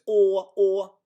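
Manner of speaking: high, loud long "o", close to "aw"; Swedish letter o. Shown as a demonstration. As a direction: hold each "o" high as the Swedish vowel. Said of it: "like, just the letter o".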